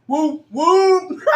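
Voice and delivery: high whooping cackle; loud, high gleeful whoops and cackles; triumphant shrieking laugh. Real, not acted.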